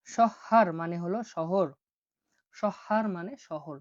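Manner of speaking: শহর drawn out slowly with a clear sh; slow, careful teacher delivery; slow teaching. Shown as a demonstration. Teacher spells out শহর slowly for students. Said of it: "দেখুন".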